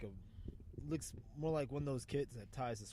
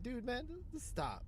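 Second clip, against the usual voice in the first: high, slow pleading; hammed-up timid plea to back off; a retold high soft "just stop"; mock soft plea; 9 semitones higher, 5 dB quieter, slower than usual. Retelling telling off a bouncer.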